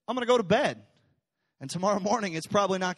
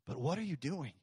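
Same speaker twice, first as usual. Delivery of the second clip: hushed question; 3 semitones lower, 10 dB quieter than usual. Acted. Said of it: "ask you".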